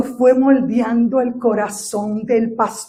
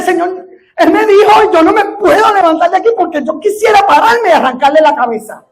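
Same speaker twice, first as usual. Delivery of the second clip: half-laughing mock complaint to God; giggling, half-joking frustration; laughing while retelling; 7 semitones higher, 7 dB louder than usual. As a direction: laugh through the retold prayer. Retold angry prayer, laughing.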